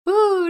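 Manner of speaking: high, drawn-out "Ooh" of dismay; a sudden high groan at her own life. Retold or imitated. Waking up back at parents' house.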